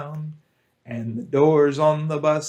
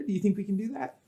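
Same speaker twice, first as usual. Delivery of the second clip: ordinary talk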